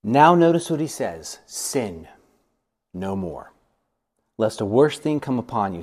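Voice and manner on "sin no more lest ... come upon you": low, soft grave warning; solemn warning. Retold or imitated. Jesus warning the healed man, low and soft. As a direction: lay it down gravely and quietly.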